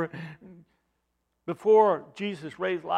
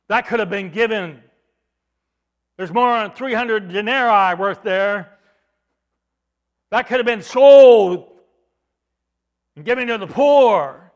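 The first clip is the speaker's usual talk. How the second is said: louder, rising indignant protest; indignant Judas; 3 semitones higher, 12 dB louder than usual. Acted. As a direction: object sharply to the waste. Judas objects to wasted perfume.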